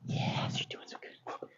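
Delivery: very soft whisper to a dog; whispered praise. Real, not acted. Trainer rewarding a dog, whispered praise.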